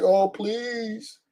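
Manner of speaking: mocking a man pleading to be taken back; mock begging. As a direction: beg softly and pathetically. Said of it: "[name]'s begging her back".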